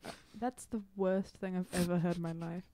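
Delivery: usual speaking voice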